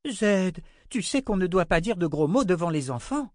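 put-on high little-girl voice; scolding child. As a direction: primly tell off an elder. Little girl scolds the old man.

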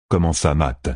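ordinary delivery

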